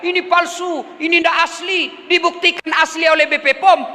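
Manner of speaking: high-pitched imitation of objecting crowd; mock protest shout. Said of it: "apalagi orang teriak".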